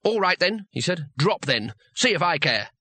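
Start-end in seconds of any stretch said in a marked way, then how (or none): 0.0-0.7 higher, mock-callous goad; harsh goading. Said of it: "It was time for harsh measures". 1.2-2.7 harsh goading. Acted. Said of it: "It was time for harsh measures".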